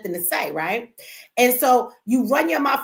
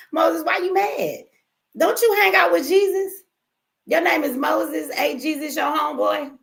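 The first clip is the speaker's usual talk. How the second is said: mocking taunt; 7 semitones higher than usual. Real, not acted. Taunting an angry commenter.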